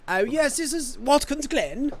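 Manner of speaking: slow, lightly posh British; a touch of a posh Brit; British accent. Acted. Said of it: "that sounds more British".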